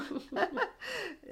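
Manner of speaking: sudden high-pitched laugh; high burst of laughter. Real, not acted. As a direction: let out a high giggle. Laughs after the card joke.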